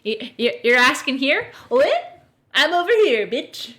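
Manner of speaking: high, loud mocking squawk; mock smug host. Mocking the podcast host, high loud squawk. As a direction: ham up a smug bro. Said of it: "he's just gonna be like".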